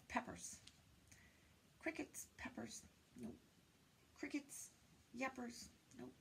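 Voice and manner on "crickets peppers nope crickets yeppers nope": whispered rhyme testing; hushed guessing. Acted. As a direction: whisper each try softly.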